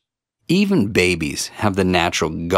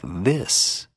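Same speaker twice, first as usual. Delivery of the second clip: vocal fry; 4 dB quieter than usual. Shown as a demonstration. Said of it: "until you could hear fullness of vocal cord vibration like".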